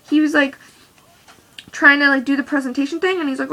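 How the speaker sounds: usual speaking voice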